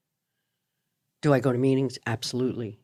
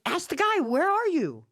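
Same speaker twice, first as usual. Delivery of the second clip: frantic mother; 14 semitones higher than usual. Retold or imitated. Frantic mom on phone, son missing.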